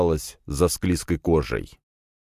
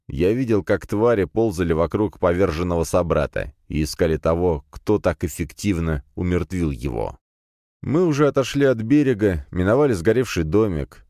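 usual speaking voice

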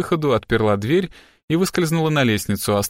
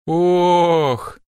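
higher, long sigh of realisation; drawn-out exhaled "oh"; 6 semitones higher, 4 dB louder than usual. A narrator's reading. Assistant finally understands it all, long exhalation. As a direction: let out one long oh. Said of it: "протяжно выдохнула Робин".

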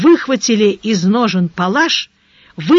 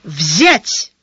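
short, abrupt command; sudden one-word order, barked; 5 semitones lower than usual. A narrator's reading. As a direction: bark it out without warning. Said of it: "рявкнул он внезапно".

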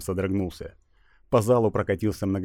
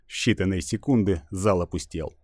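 ordinary talk

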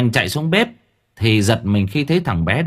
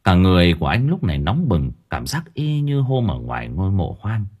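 ordinary delivery

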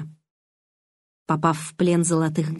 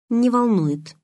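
higher-pitched firm reply; firm answer; 4 semitones higher, slower than usual. A narrator's reading. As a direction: state it firmly, falling at the end. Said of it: "твёрдо ответила я".

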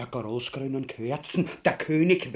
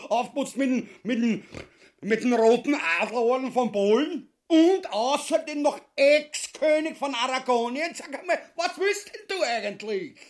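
high, loud pompous bluster; blustering boast; 9 semitones higher, 4 dB louder than usual. Acted. Ubu bragging to his wife, high and loud. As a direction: stumble over the medal, still puffed up.

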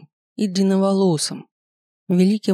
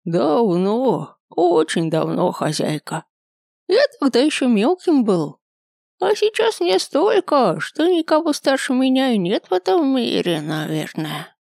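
put-on very old man; 7 semitones higher than usual. A narrator's reading. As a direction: groan it out like a frail old man. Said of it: "прокряхтел дед".

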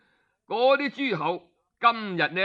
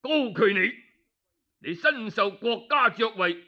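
higher, indignant accusation; accusing outburst; 6 semitones higher than usual. Acted. The duke turns on the guilty minister, furious and accusing. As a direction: nail him as the murderer.

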